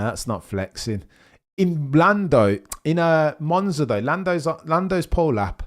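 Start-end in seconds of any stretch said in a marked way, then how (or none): 1.6-5.6 rising excitement over the onboard; excited. Real, not acted. Excited about Lando's flexing wing.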